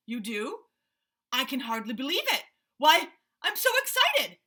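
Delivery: building to high, loud delight; excited rhinoceros. Acted. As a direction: squeal with joy. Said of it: "danced around in a circle".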